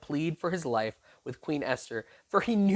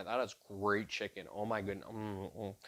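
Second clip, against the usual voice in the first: mock munching glutton; 8 semitones lower, 12 dB quieter than usual. Haman gorging at the banquet.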